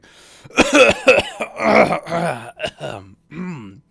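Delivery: loud hacking coughing fit. Acted. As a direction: cough hard on the smoke. Stoner NPC chokes on pipe.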